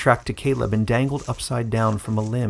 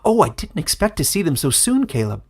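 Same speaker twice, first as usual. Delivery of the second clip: babbling; 6 semitones higher, 3 dB louder than usual. A narrator's reading. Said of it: "he babbled".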